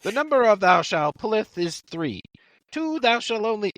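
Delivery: high, mock-solemn scripture reading; Monty Python recitation. Quoting the wrong fantasy before part three, mock-solemn recitation. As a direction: intone it high like comic holy writ. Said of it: "Monty Python?".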